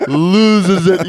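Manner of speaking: ordinary delivery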